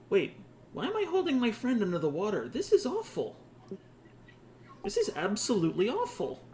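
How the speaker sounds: high-pitched, appalled inner voice; suddenly horrified at what you're doing; dismayed realization. Acted.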